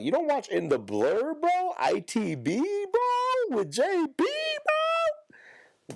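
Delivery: excited fan pitching up on every bro; hyped-up fan. Retold or imitated. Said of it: "Y'all motherfuckers will be like".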